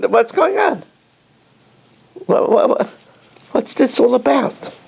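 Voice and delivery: as if you can't follow it; bewildered. Real, not acted. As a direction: rabbi baffled by the song. Baffled at the singing.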